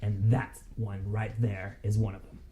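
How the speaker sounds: lightly voiced suspicious thug. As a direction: warn them like a shady tough. Krenko voiced by the GM.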